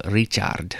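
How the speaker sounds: light imitation of a Polish accent. Comic demonstrating own name, light Polish accent. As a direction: say the name as a Polish speaker would. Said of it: "in somebody else's Polish accent sounds like".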